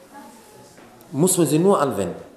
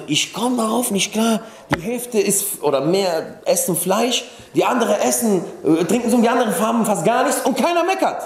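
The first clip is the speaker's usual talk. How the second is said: imitating an amazed guest, high-pitched; retold bewilderment, pitched up and insistent; verblüfftes Staunen; 8 semitones higher than usual. Verblüffter Gast wird nachgespielt. (fassungslos staunend, mit hoher Stimme)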